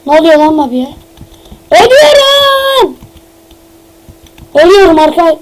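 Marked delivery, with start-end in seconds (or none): 1.7-3.0 long, high-pitched dying wail; over-the-top death cry; mock death scream.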